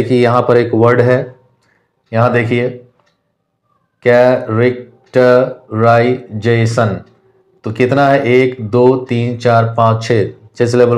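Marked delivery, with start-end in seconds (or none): 4.0-7.0 कै-रेक-ट-राइ-जे-शन, slow with short gaps; syllable by syllable. Shown as a demonstration. Teacher showing students how to count syllables, syllable by syllable. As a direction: break characterisation slowly into six syllables. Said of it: "कितने बार नीचे drop करता है".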